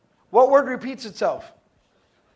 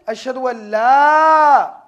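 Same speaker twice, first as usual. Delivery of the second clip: "la" held very long and loud; emphasis on la; 9 dB louder, much slower than usual. Shown as a demonstration. Teacher showing the class the special la, heavily emphasized. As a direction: stretch "la" out at full voice. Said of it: "has emphasis?".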